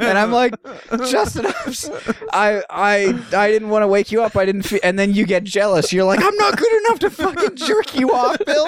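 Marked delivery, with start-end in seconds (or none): 1.1-4.8 high stammering mock excuse; flustered. To Justin, a high stammering flustered excuse. 6.2-8.7 high mock-jealous whine. Jealous twin to Bill, a high mock-jealous whine.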